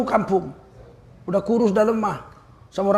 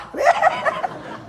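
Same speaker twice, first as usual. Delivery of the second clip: a sudden high burst of laughter. Real, not acted. Preacher laughing at the greedy boss's twisted mouth, high laugh. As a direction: laugh high and free at the punchline.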